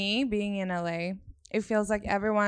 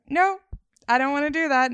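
much higher, louder no, then a firm refusal; retold loud refusal; 6 semitones higher, 7 dB louder than usual. Retelling her old self refusing the Sweden path, loud. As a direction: fling out the no as her past self. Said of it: "And I was like".

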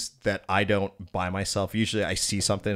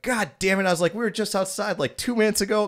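high-pitched mock exasperation; replayed frustrated protest, high but not shouted; retold exasperation; 11 semitones higher than usual. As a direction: act out exasperated disbelief, pitched well up. Retelling exasperation at dog's mess.

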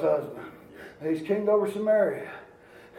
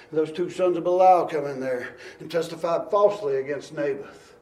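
ordinary talk

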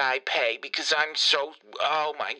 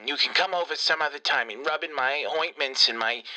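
ordinary talk

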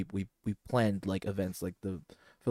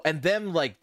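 normal talking voice

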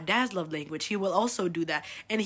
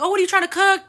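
high, louder eager asking; pleading question; 10 semitones higher, 7 dB louder, much faster than usual. Retold or imitated. Asking mom which pan, much higher and louder. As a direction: ask your mom, pitch way up.

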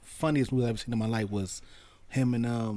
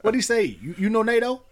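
much higher and louder quoted question; movie quote; 10 semitones higher, 5 dB louder than usual. Retold or imitated. Friend reenacting a funny movie line, loud and high. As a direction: fire off the line like the movie scene. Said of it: "He said".

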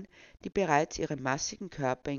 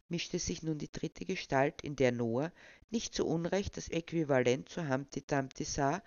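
usual speaking voice

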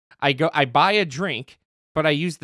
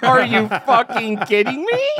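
high, loud, slow, laughing through it; laughing disbelief; 9 semitones higher, 6 dB louder, slower than usual. Retold or imitated. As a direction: crack up at the absurdity. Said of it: "I just am like".

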